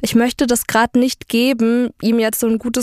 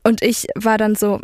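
normal talking voice

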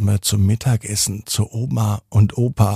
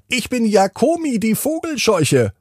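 higher, strange scarecrow voice; 11 semitones higher than usual. A narrator's reading. Scarecrow greets Dennis in the garden, higher storybook voice. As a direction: introduce yourself as a friendly scarecrow. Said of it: "fragt die Vogelscheuche".

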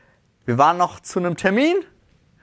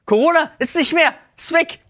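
much higher and louder, mock outraged inner voice; high, louder, sarcastic inner thought at the pushy man in the queue; sarcastic disbelief; 14 semitones higher, 3 dB louder than usual. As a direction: squeak it out in ironic shock that the rules are gone. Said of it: "Und ich dachte einfach so".

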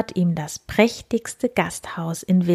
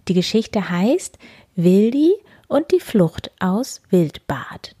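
ordinary delivery, nothing marked

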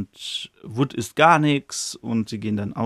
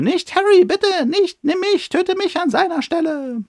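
much higher, begging woman's voice; mother begging the killer to spare her son; desperate mother; 3 dB louder than usual. Retold or imitated. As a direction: plead for the child's life in a high voice. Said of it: "hört er wieder die Frauenstimme".

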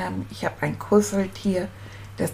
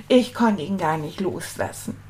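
ordinary delivery

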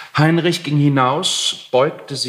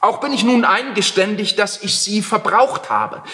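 higher, soothing Heinrich; calm him down lightly; placating; 6 semitones higher than usual. A narrator's reading. Heinrich soothes the angry landlord, placating.

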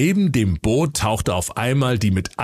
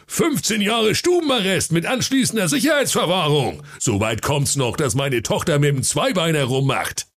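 angry royal decree, somewhat higher; commanding king; 8 semitones higher than usual. Acted. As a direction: lay down the law to his daughter. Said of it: "seiner Tochter befahl er". (befehlender Seekönig)

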